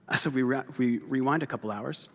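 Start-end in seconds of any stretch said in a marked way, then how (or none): none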